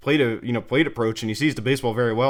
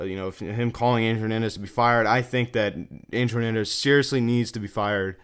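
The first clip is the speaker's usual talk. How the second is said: ordinary talk, nothing marked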